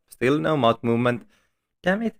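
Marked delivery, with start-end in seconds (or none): 1.8-2.2 high, frustrated yelp with a rise; frustrated squeak. Real, not acted. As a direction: squeak it out in annoyance. Camera fails again.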